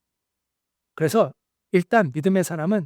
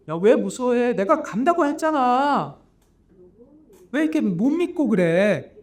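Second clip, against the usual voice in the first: higher, louder exasperated rebuke, slowing on the second line; gentle scolding; 6 semitones higher, 3 dB louder than usual. Acted. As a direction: chide them like a disappointed teacher. Jesus scolds fearful disciples. (답답해하며 높은 목소리로 꾸짖기)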